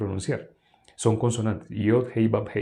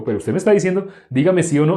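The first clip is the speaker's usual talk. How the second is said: mock pushy questioner; 7 semitones higher, 7 dB louder, faster than usual. Quoting pushy people demanding yes or no.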